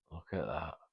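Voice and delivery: fading to almost nothing, awestruck; hushed awe. Retold or imitated. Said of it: "her eyes and her mouth, like".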